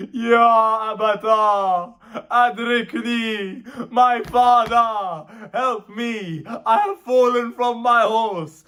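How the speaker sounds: high, slow, drawn-out wail; dying son's cry. Retold or imitated. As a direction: cry out to a father for help. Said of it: "a cry is heard".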